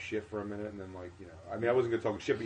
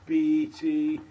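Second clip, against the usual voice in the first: higher, slower, building announcer hype; hype-man host intro; 7 semitones higher, 7 dB louder, slower than usual. Retold or imitated. Host stalling while forgetting the name, building hype intro. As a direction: pump up the crowd like a flustered club host. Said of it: "He goes".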